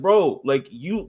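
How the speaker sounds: ordinary delivery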